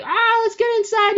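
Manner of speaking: a high, loud retold yelp; mock scream. Two characters caught in rain, mock scream. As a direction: squeal it like caught in the downpour. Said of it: "they end up screaming".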